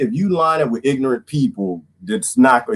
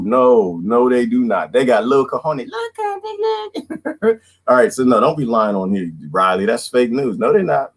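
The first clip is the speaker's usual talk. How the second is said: ordinary talk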